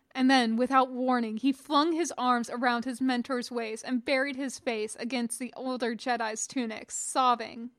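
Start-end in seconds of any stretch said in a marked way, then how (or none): none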